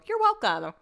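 high, mock-gracious "you're welcome"; sarcastic. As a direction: toss it off as an ironic favour. Joke after nose-blowing on air.

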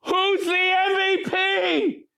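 high, slow, desperate yell over the chaos; stretched-out frantic yell; yelling at top of voice. Retold or imitated. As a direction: shout the question across a noisy stage. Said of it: "I'm yelling at the top of my voice".

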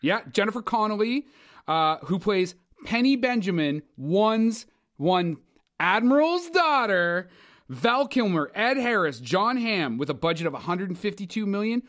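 hyped movie announcer. Acted. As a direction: sell the blockbuster with over-the-top excitement. Movie announcer hype pitch.

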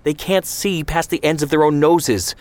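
high, pushed indignant tirade; heated rant. Acted. Ben Shemen berates Zvi about the Etzel, heated. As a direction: hammer him with each point.

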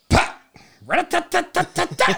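loud, high rat-a-tat gunfire mouth sounds; gunshot noises. Retold or imitated. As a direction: shout out the gun bursts.

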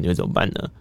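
normal talking voice

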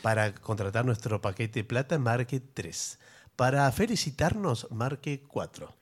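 slow, soft recorded menu voice; phone menu voice. Pretend. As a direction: read it like an automated phone line. Automated phone line menu.

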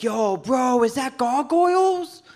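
high-pitched, climbing excited question. Acted. Comic spots roommate watching favourite cartoon, excited. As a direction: burst out to a buddy in delight. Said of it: "I got so excited".

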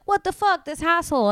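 high, loud, exasperated mock outburst; irritated bystander griping about the hassle; annoyed bystanders. Annoyed bystanders voiced in story.

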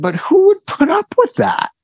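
asking the doctor in disbelief; incredulous. Retold or imitated. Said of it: "And I said".

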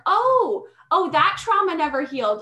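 high, loud startled "oh"; mock surprise. She imitates her own inner voice, mock surprised and high. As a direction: act like you just found an old wound. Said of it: "and I'm like".

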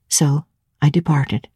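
plain reading voice

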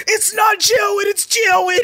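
high, drawn-out yell with R's as W's; enraged kid impression. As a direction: shriek the correction as the furious classmate. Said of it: "he was enraged".

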